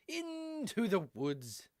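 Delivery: long drawn-out, high opening word; theatrical sign-off. Pretend. Bear heading back at stream's end, drawn-out theatrical send-off. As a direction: stretch it out like a storybook send-off.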